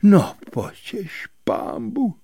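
high, whispery horror; slow, breathless dismay; frightened blurt. A narrator's reading. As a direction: let it slip out aghast, high and airy. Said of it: "vyjelo z něj".

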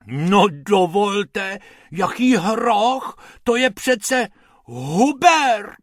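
slow, high, haughty protest; offended, drawing it out; indignant lady. Acted. The lady scolds the rabbits, slow and indignant.

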